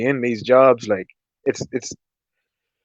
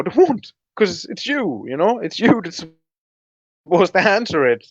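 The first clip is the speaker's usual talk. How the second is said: mocking the nurse; 7 semitones higher than usual. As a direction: throw the excuse back at her, much higher. Patient mocking the nurse's excuse.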